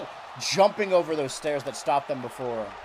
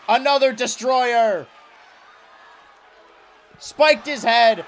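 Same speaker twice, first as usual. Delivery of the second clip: booming, slow move call; shouted call; 7 semitones higher, 8 dB louder, slower than usual. Acted. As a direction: yell it like a ring announcer. Commentator calls a big move.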